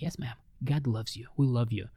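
soft reassurance. Retold or imitated. He repeats to the crying lady that God loves her, soft and tender. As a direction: say it gently to comfort her.

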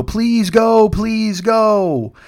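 high, drawn-out exaggerated begging; mock pleading. Friends begging her to come to the movie, mock pleading. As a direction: plead in a mocking high whine. Mimics the begging she wanted.

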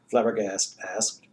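ordinary delivery

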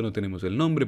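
ordinary delivery

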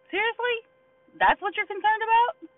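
high-pitched, loud disbelief; incredulous. Acted. Preacher challenging leaders fussing over a mat, incredulous. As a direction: question it way up high, as if astounded.

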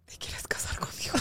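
whispered, tender question; hushed proposal. Retold or imitated. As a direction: breathe the proposal softly. Retold proposal line, hushed.

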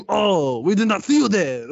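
high, exaggerated French accent; heavy French accent. Host quoting guy on group date, heavy mock French accent. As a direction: imitate a Frenchman with a thick, hammed-up accent. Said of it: "goes in a heavy French accent".

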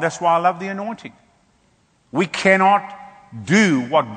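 rising, forceful sermon cadence; preaching. Acted. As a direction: drive it home to the congregation.

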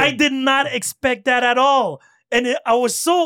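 really marvelling at the twist; excited. Real, not acted. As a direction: recalls the ad, loud and amazed. Excited retelling of the ad.

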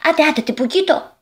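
impatient. Acted. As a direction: egg the friend on to get started. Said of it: "ανυπόμονα". (ανυπόμονα, με ψιλή φωνή)

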